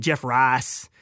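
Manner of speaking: Southern accent, "Rice" flattened to "Rahs" with no glide; Southern drawl on "Jeff Rice", vowel "ah" not "eye". Shown as a demonstration. Host shows how he usually says the name, Southern accent. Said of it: "'cause that's my Southern accent".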